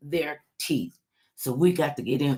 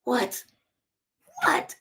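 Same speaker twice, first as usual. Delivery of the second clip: mock indignant woman; 5 semitones higher than usual. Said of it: "Chick come up to a fella".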